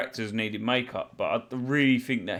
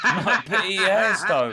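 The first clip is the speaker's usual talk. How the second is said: laughing through the words, a bit louder; 5 semitones higher, 6 dB louder, slower than usual. Real, not acted. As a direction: laugh out the protest. Laughing protest.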